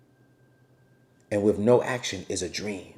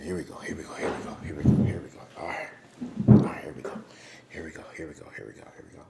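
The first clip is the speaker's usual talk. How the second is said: hushed; 8 semitones lower, 4 dB quieter, much faster than usual. Real, not acted. Hushed while filming outside.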